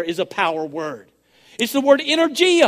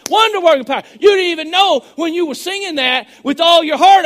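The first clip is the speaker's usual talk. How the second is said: loud, rising preacher's cry; fired-up pulpit preaching on power; full-force preaching; 4 semitones higher, 5 dB louder than usual. Acted. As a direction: preach it out, building to a shout.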